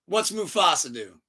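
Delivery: normal talking voice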